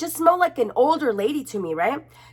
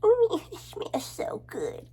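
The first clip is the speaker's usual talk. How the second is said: put-on high breathy whispered baby voice; mock baby whisper, high and soft; baby's voice; 10 semitones higher, 7 dB quieter, slower than usual. Baby imagined sniffing, whispered.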